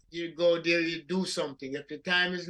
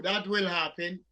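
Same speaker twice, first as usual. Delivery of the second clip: normal talking voice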